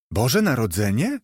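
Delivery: ordinary delivery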